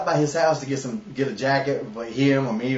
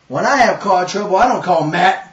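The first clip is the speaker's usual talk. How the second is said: put-on gruff old man; 4 semitones higher, 8 dB louder than usual. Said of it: "And he goes".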